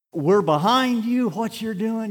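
high, booming encourager's voice, drawn out at the start; loud, high-pitched mannered cheerleading; Gene Crail. Acted. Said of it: "You know his mannerisms, how he talks?".

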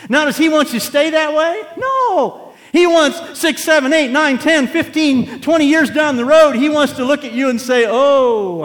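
loud, high, driving sermon voice; full-force preaching. Acted. Preacher rousing the congregation, loud full-force preaching. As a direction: preach it hard to the back pews.

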